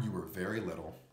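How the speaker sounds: ordinary delivery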